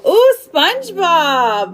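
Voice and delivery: drawn-out loud excited ooh; delighted, slow and loud; excited squeal. Real, not acted. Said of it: "I got excited".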